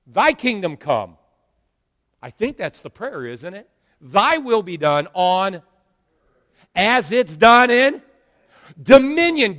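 fiery pulpit preaching. Acted. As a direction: preach it out with full force. Preaching at full force.

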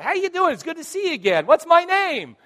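high, loud gushing greeting; mock eager greeting. Mall acquaintance testing the pastor, loud and over-eager. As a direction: pounce with a high-pitched hello.

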